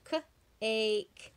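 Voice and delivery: K-ake with the A drawn out; stretched A sound. Shown as a demonstration. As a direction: pull the A in K-ake apart. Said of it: "makes it say its own name".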